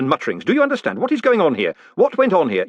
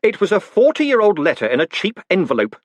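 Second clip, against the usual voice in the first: louder, raised angry reply; irritated snarl at the old postman; 9 semitones higher, 5 dB louder than usual. Acted. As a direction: snap it back, fed up. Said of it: "he snarled".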